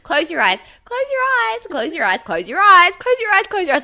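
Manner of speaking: loud, high, insistent repeats; pleading teacher. Retold or imitated. Teacher pleading with restless kids, loud and high. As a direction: keep begging the class to close their eyes. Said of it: "I was like".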